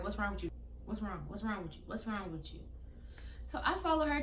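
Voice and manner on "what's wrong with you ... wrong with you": fading self-questioning. Retold or imitated. Quoting self-doubt quietly.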